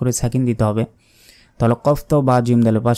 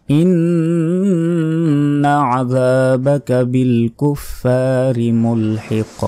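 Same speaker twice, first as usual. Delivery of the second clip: কুরআন তিলাওয়াত; 2 semitones higher, much slower than usual. Shown as a demonstration. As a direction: recite with drawn-out ghunnah and long vowels. Recites the verse being taught. (সুর করে, গুন্নাসহ ধীর তিলাওয়াত)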